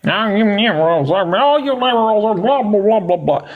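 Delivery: slow, high, mocking parody of the guest's rant; mocking imitation. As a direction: throw his words back at him in a mocking sing-song. Mocking the guest's own words.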